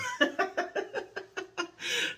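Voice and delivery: a burst of high laughter at herself; real high-pitched laugh. Real, not acted. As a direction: laugh off the impression. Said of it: "laughs at own impression".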